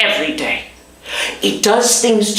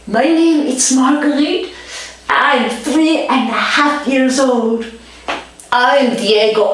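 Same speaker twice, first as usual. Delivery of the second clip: put-on higher, slow, careful little child voice; little kid voice; 6 semitones higher, slower than usual. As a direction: play a shy small child saying their name. Small kids introducing themselves.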